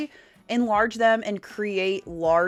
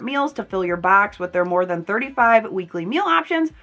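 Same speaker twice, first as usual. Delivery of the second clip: louder upbeat ad read; 3 semitones higher, 7 dB louder than usual. Acted. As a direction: sell it like a sponsor spot. Sponsored ad read.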